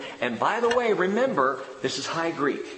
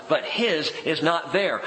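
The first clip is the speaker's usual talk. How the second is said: ordinary talk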